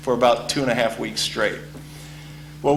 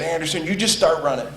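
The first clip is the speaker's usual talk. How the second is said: higher, rising order; fed-up coach; 7 semitones higher than usual. Retold or imitated. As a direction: send him off running.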